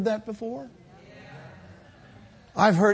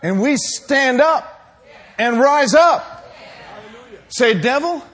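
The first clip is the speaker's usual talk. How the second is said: loud, raised, emphatic sermon delivery; full-force preaching; 3 semitones higher, 6 dB louder than usual. Acted. Believer standing up to the devil, full-force preaching. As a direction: preach it hard, punching the phrases.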